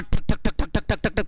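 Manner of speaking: rapid, repeated "thak-thak" engine noise; imitated engine chugging. Teacher describing a jugaad vehicle to students, imitated engine chugging. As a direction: chug like a rattling makeshift motor.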